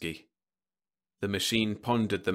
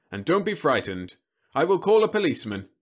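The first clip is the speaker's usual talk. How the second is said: reassuring robot; 8 semitones higher, 7 dB louder than usual. Acted. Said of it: "Roby said reassuringly".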